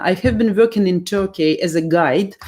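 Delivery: usual speaking voice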